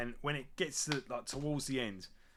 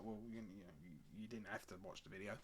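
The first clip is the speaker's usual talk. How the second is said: much softer, low grumble at the haters; muttered aside; 6 semitones lower, 19 dB quieter, faster than usual. Real, not acted. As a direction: mutter it under your breath, fed up.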